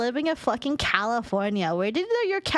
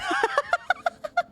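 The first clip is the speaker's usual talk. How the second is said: laugh. Real, not acted. Laughing at chat comment.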